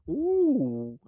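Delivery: high, held "ooh"; goosebumps shiver "ooh". Retold or imitated. Beer drinker getting goosebumps, high shivery ooh. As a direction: let out a high, chilled ooh. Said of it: "you get, like, goosebumps".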